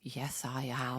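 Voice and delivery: breathy whispered reply; the mother answering her defiant daughter, whispered; mock whisper. Playing the mother in response.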